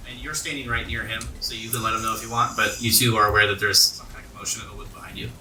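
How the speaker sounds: normal talking voice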